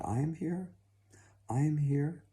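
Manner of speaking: high, sweet daughter's voice; sweet reply from the bed; delightful sweet voice. A narrator's reading. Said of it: "in the most delightful, sweet voice".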